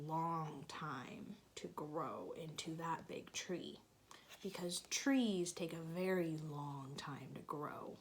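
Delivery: soft unvoiced whisper. Real, not acted. Aside to child while reading.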